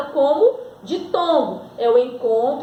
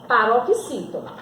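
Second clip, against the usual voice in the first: usual speaking voice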